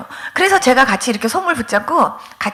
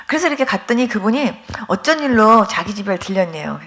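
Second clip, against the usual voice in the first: usual speaking voice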